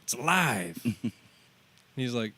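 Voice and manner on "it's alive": loud, high, amazed Walken impression with a laugh; Christopher Walken. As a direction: gasp at the car like it's a living thing, Walken-style. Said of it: "he does it in Christopher Walken's voice".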